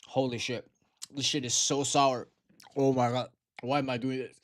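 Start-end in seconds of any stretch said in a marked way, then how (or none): none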